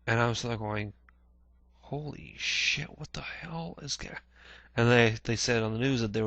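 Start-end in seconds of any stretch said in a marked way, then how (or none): 1.8-4.2 higher, slow and fading softer; trailing off in disbelief at the screen; stunned shock. Retold or imitated. Watching every channel cover the attacks, slow stunned and fading.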